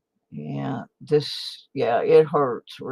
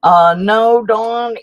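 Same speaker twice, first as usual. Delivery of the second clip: loud call; 9 semitones higher, 13 dB louder, slower than usual. Real, not acted. Answering teammate loudly.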